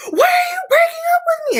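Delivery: high-pitched mock sobbing shriek; imitated tearful wail. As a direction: wail it like a hammed-up heartbroken girlfriend. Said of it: "she's crying, and she's sobbing".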